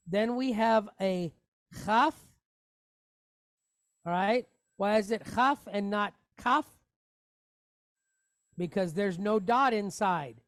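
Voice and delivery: normal talking voice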